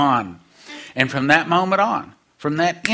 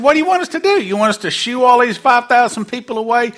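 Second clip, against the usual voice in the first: much higher and louder, pushy disciple; exasperated disciple; 9 semitones higher, 5 dB louder, faster than usual. Acted. Disciple asking Jesus to send the crowd away, high and loud. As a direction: squeal it up high like a fed-up follower.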